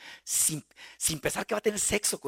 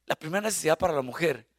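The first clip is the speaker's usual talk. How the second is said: usual speaking voice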